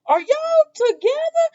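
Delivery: very high, loud imitated question; mock suspicion. Susan asking the two if they're together, mock suspicion. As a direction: shoot it up high in disbelief.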